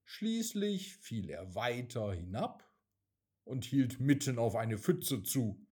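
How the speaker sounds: ordinary delivery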